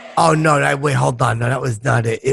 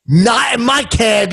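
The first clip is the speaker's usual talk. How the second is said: high, slow punchline refusal; cabbie's mock-outraged comeback; indignant cabbie; 7 semitones higher, 3 dB louder than usual. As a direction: draw out the refusal, pitched up. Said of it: "And the fucking cabbie goes".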